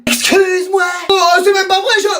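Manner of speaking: high, shouted outrage; high shouted denial; indignant protest. Acted. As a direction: cry out in offended disbelief. Sibling outraged at accusation.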